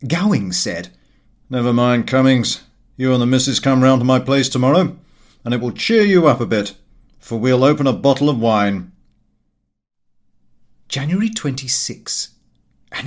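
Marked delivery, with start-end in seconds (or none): 1.5-8.9 louder, brisk and jovial host; hearty loud invitation. A narrator's reading. Gowing inviting Cummings round for wine, loud and jovial. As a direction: boom it out cheerily and quickly.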